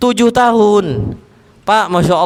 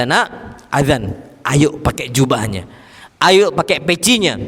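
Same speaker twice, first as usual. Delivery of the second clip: gentle coaxing; 6 semitones lower than usual. Retold or imitated. Parent gently inviting child to mosque.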